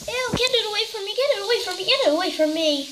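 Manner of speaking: high, fast, repeated disgusted pleading; phobic panic. Acted. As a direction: beg it to stay away in a panic. Recoiling from the goo.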